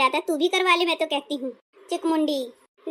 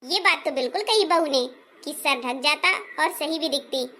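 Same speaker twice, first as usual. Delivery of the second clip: ordinary talk, nothing marked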